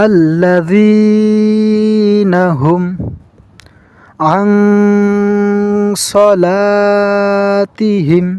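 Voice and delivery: slow melodic tajweed chant; chanted verse; Quran recitation. Shown as a demonstration. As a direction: recite like a qari, long vowels.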